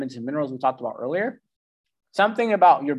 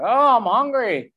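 high, loud and drawn-out whine; whiny hungry brain; 9 semitones higher, 5 dB louder, slower than usual. Retold or imitated. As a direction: moan it like a hungry stomach begging. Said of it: "It plucks you in the back of the head, like".

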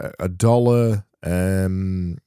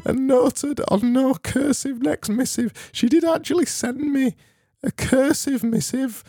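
very high put-on voice; very high falsetto reading voice; different voice. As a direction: read it in a squeaky high character voice. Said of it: "I'm gonna read this in a different voice".

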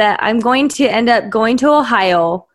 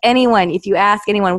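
ordinary talk, nothing marked

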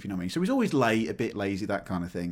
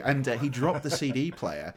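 ordinary talk

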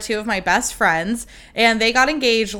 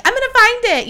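high, loud mock-eager announcement; playful pretend determination; 14 semitones higher, 5 dB louder than usual. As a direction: declare a pretend treasure hunt. Pretend ring hunt, retold.

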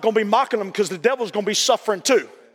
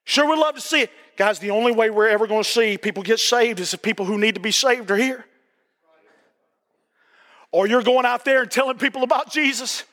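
usual speaking voice

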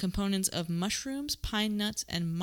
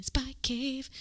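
hushed mock-mysterious whisper; spooky whisper; 7 semitones higher than usual. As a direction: whisper it like a spy secret. Repeats the name whispered.

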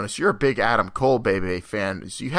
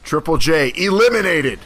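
mock ring announcer call; 6 semitones higher, 5 dB louder than usual. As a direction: announce it like a wrestling elimination. Mock announcing his exit.

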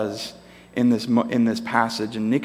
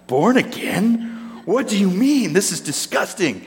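disgusted; 8 semitones higher than usual. Acted. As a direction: recoil at the very idea. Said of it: "like, disgusted".

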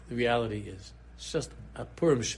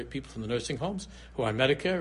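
normal talking voice

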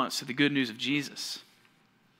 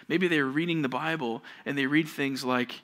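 ordinary talk

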